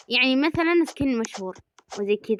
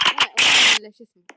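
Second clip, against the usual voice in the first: usual speaking voice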